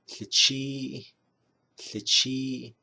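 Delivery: Tlichi said slowly, breathy tl at the start; Navajo word for red. Shown as a demonstration. Teacher showing viewers the Navajo word for red, clearly. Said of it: "you say".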